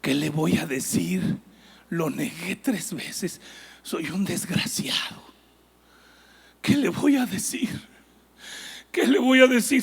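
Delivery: slow, soft, regretful self-talk; ashamed inner thoughts. Acted. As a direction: mutter it, dreading the meeting. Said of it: "él iba pensando".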